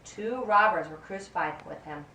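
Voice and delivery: plain reading voice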